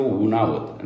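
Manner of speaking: ordinary talk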